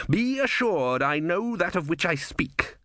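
high, slow, deliberate assertion; slow, imperious pronouncement; commanding Vorbis. A narrator's reading. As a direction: cut off objection with measured certainty. Vorbis silences the sergeant.

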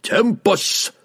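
high-pitched battle cry; Wulfgar bellowing. A narrator's reading. Barbarian warning the drow of his throw, bellowing.

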